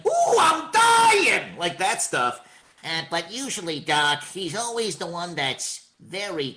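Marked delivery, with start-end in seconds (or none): 0.1-1.6 high, slow cartoon death cry; Bugs Bunny voice. Shown as a demonstration. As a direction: wail it like a hammy rabbit. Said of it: "he can get up there".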